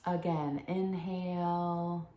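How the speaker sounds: unhurried, hushed breathing instruction; guided meditation. Acted. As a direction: guide them gently into calm, phrase by phrase.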